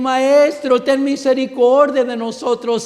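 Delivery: a loud, high plea to the Master; crying out. Retold or imitated. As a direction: raise your voice and beg for mercy. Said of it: "alzaron la voz".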